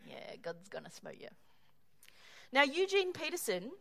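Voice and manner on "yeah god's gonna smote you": smug mutter. Retold or imitated. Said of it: "there are people we would say".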